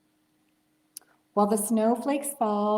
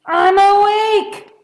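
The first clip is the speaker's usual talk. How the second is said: big high roar, much louder and slow; roaring bear; 12 semitones higher, 14 dB louder, slower than usual. Acted. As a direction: roar in frustration like a sleepless bear. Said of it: "roars Bear".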